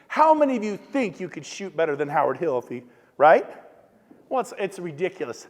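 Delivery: ordinary talk, nothing marked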